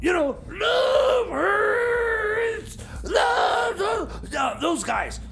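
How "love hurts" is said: ordinary delivery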